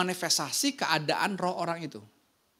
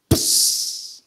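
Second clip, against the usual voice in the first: smash sound; 10 dB louder than usual. Retold or imitated. Imitating smashing a coconut.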